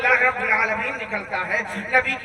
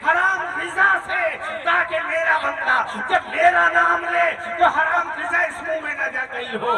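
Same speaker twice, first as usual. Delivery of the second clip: voice of the Lord; 5 semitones higher than usual. Acted. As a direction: preach God's words slowly and grandly. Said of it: "رب کی آواز آئے گی ... جب سنو گے کہ". (بلند اور دھیمی پُرجلال آواز)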